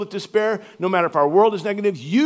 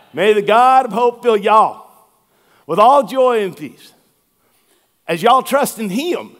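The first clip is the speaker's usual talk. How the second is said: louder, slow Southern preacher read; Deep South accent; 5 dB louder than usual. Retold or imitated. Louisiana-born pastor reading the verse Southern style. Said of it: "In the Deep South".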